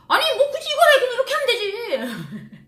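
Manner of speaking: high, loud disbelief; Anna scoffing at a silly habit; incredulous friend. Retold or imitated. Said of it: "그러니까".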